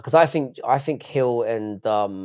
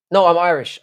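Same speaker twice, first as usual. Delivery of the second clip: blurted slip; 6 semitones higher, 7 dB louder than usual. Real, not acted. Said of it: "I can't believe I let that come out".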